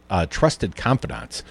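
ordinary talk

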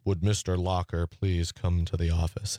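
low, level school loudspeaker announcement; lightly mimicked official intercom voice; principal's announcement. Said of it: "the principal coming on the loudspeaker saying".

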